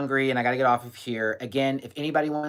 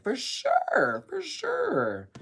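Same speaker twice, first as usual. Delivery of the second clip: high-pitched reply; 12 semitones higher, much slower than usual. Retold or imitated. Voicing own reply to fan.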